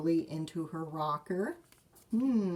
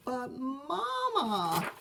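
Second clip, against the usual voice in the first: whining cub; 8 semitones higher, much slower than usual. Acted. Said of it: "cubs whine".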